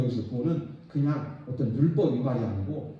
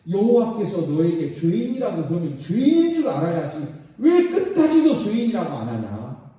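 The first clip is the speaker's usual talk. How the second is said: scolding voice of God; 5 semitones higher, 6 dB louder than usual. Acted. God rebuking Israel in sermon.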